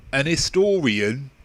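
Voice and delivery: slow Cockney "an 'istorian", H dropped; Cockney accent. Shown as a demonstration.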